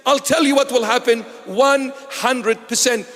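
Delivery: normal talking voice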